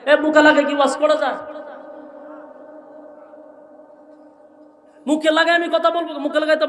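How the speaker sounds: loud, high indignant challenge; indignant shout. Real, not acted. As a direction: protest loudly. Preacher arguing about mic technique. (ক্ষুব্ধ চিৎকার)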